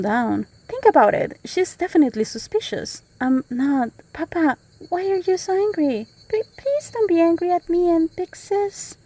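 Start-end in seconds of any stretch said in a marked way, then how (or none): 4.1-9.0 put-on high childlike voice begging Papa; pleading little girl. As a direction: plead like a small scared daughter.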